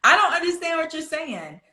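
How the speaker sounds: high-pitched, exaggerated questioning voice; puzzled listener. People asking an accented speaker to repeat, high-pitched puzzlement. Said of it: "people can stop asking you".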